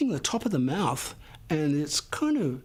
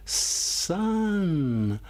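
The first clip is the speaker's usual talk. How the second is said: slow; 3 semitones higher than usual. Shown as a demonstration. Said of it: "say sun very slowly".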